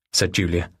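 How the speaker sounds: plain reading voice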